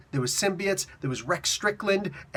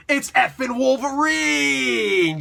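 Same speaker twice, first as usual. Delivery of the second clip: high, loud, long drawn-out announcement; hyped reveal; 7 semitones higher, 5 dB louder, much slower than usual. Acted. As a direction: stretch the name out like a big entrance.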